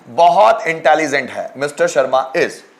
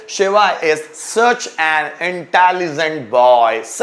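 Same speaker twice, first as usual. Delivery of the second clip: very slow, each word spaced; slow dictation; much slower than usual. Shown as a demonstration. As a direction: read it out slowly for students to write. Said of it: "teacher dictates example sentence".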